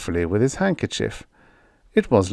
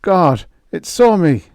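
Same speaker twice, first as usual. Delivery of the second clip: frightened mutter; 9 semitones higher, 8 dB louder than usual. A narrator's reading. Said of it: "It seemed to frighten him".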